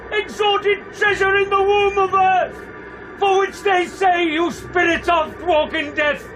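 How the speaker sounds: desperate high, loud challenge to a ghost; alarmed shouting. Acted. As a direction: shout it out at the apparition, high and urgent.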